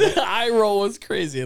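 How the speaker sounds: talking through laughter; laughing while speaking. Real, not acted. Laughing while telling story.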